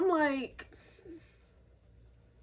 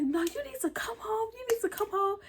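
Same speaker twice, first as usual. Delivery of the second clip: insistent mom; 8 semitones higher, 3 dB quieter than usual. Retold or imitated. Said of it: "And my mom was like".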